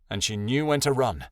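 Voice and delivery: plain reading voice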